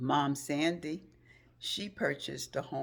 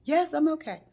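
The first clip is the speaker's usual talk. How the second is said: high, loud anxious voices; urgent back and forth; anxious exchange; 9 semitones higher, 9 dB louder, faster than usual. Retold or imitated. Two friends on the phone after the crash, urgent.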